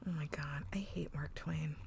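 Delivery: very soft, voiceless muttering; exasperated whisper. Real, not acted. Podcaster venting about a hated character, exasperated whisper. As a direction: whisper it under your breath, fed up.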